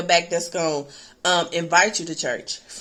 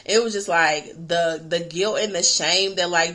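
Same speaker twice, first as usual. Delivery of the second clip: ordinary talk, nothing marked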